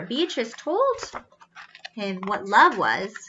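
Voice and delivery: ordinary delivery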